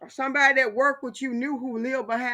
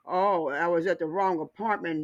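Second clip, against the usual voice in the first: lower, sneering mock excuse; 7 semitones lower than usual. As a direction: drop low and mock the excuse. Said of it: "And now you're gonna say".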